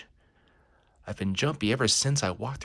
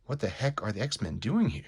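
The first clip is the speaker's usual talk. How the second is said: ordinary talk, nothing marked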